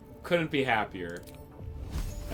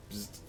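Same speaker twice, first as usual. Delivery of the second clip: buzzing zap noise; 14 dB quieter than usual. Pretend. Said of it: "Do it again".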